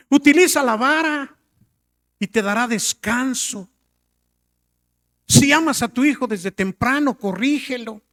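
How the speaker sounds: oración ferviente, más aguda y fuerte; clamor a Dios. Retold or imitated. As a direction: clama al Señor con fervor. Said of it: "clamaba yo a Dios". (el pastor clama a Dios por su hija, con fervor)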